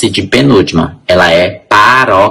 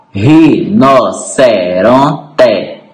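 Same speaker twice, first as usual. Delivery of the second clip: "Rinoceronte" slowly split as ri-no-ce-ron-te; syllable by syllable; much slower than usual. Shown as a demonstration. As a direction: count out five separate syllables in "Rinoceronte". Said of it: "cinco sílabas". ("Rinoceronte" pausado, sílaba por sílaba)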